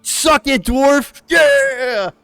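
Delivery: high, loud taunting cheer; gloating victory shout. Acted. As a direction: crow loudly over a beaten rival. Acting out gloating on the table.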